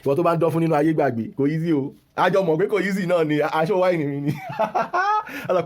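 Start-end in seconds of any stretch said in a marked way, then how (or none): 4.4-5.4 high-pitched burst of laughter; real laugh. Real, not acted. Speaker amused at own remark mid-rant, high laugh.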